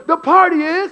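high, loud preacher voice rising to invite the crowd; preacher calling out. Retold or imitated. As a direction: leave it hanging for the church to finish. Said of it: "And he goes".

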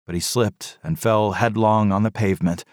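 ordinary delivery, nothing marked

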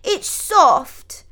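put-on high, loud toddler voice; loud little-boy insistence; young dissenter. A narrator's reading. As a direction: protest like a stubborn small child. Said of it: "the young dissenter".

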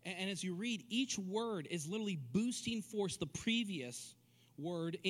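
ordinary talk, nothing marked

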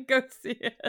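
high, loud, breaking up with laughter; laughed-out plug, pitched high; laughing. Real, not acted. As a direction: urge them through real laughter. Joking plug amid laughter.

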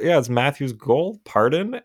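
high, loud baffled pardon; mock announcer surprise. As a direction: play the puzzled broadcaster. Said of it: "they start talking about".

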